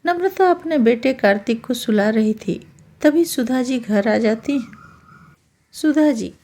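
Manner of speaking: ordinary delivery, nothing marked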